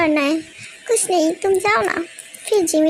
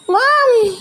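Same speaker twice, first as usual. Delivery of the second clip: excited call; 7 semitones higher, 5 dB louder, much slower than usual. Acted. Child greeting loudly.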